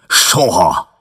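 furious refusal to shake hands; angry shout. Acted. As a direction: bark the refusal. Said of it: "kiáltotta dühösen Baldwin".

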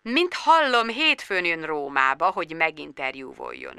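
soft, sinking, offhand murmur; gentlemanly nonchalance. A narrator's reading. Actor leaves a phone message for Bridget, nonchalant. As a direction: speak low and unhurried, trailing down. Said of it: "úri, mély, nem törődöm hang".

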